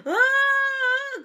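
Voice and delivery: a sudden high, loud wail, acted out while retelling; retold crying wail. Wife retelling her meltdown to viewers: high loud mock wail. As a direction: let out a hammed-up sob cry, like snot running down the stairs.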